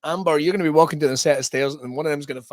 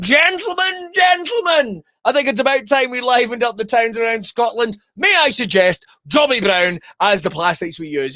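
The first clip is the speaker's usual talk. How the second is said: high, declaiming call to a board meeting, settling into a pitch; hammed-up architect making his proposal; pompous seventies architect; 6 semitones higher than usual. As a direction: address the gentlemen grandly. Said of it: "some fucking architect in the seventies went".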